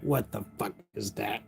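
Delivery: a grumbling dad under his breath; mock dad muttering, very soft; dad's mutter. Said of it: "he's just like".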